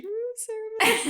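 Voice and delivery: muffled bellboy voice. Retold or imitated. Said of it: "When he's like".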